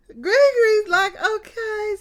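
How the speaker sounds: high, loud laughing voice; laughing through it. Retold or imitated. Retelling Gregory's reaction, voice pitched high with laughter. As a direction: squeal it out while laughing.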